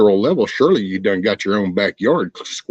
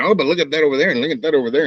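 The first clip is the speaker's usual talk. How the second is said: mocking deflection; 6 semitones higher, much faster than usual. Mocking people who deflect.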